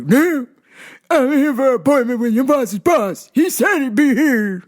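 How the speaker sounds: put-on old retired bodybuilder voice; high, loud meathead impression; gym bro voice. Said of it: "that old retired bodybuilder voice".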